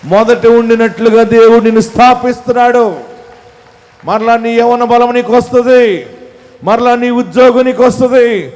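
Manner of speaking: high, loud ringing proclamation; proclaiming shout. Acted. Pastor proclaiming restoration to the congregation, ringing shout. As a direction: shout the promise over the crowd.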